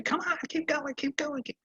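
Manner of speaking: nagging. Retold or imitated. Annoyed rider nagging horse.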